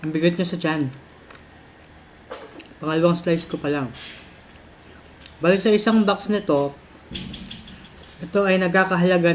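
speaking between bites; chewing while talking. Real, not acted. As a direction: mouth partly full. Seen 1: Fork with food at lips early; eating throughout; chewing motion unconfirmed.

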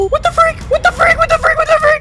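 very high rapid squeal; squeaky alarm. Pretend. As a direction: squeak it over and over. Startled squeaking, repeated.

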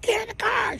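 high mock yell. Mother yelling at her kids to get in, high mock yell. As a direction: pitch it way up like a panicked mom. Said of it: "She's yelling at the kids".

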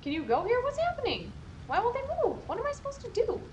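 high, loud rising panic; panicking. Real, not acted. Her prisoners won't move in the fire, real panic.

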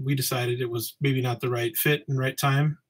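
ordinary talk

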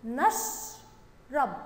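نشرب very slowly, نش held, then رب; slow syllabic reading. Shown as a demonstration. Teacher reading along with pupils, نشرب slow and in syllables. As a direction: split نشرب after a long sh. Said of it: "يلا نقرأها مع بعض".

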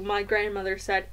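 ordinary delivery

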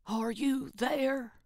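lightly voiced stern headmistress, slow; a hint of an angry schoolmistress; irate Miss Trunchbull. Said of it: "sounded like an irate Miss Trunchbull".

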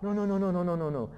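loud, rapid-fire string of refusals; head her off before she goes; hasty restraining. Acted. Father hastily restrains his daughter.

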